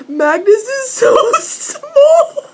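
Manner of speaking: high squealing laugh through the words. Real, not acted. Fan squealing at the couple, laughing high. Squealing over size difference.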